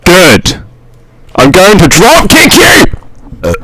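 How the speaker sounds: high, very loud, drawn-out shout; mock-threat yell. Host playfully threatening his podcast friends, mock-threat yell. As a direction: blast it out as an over-the-top threat. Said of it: "I could hear you turn your gain up".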